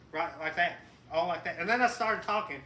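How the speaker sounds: usual speaking voice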